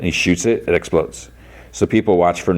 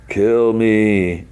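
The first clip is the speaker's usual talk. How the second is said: drawn-out, slightly raised groaning plea; distorted voice; 4 semitones higher than usual. Retold or imitated. Severed head begs Lucy, distorted drawn-out voice. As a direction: imitate a distorted, barely living head. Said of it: "in a distorted voice".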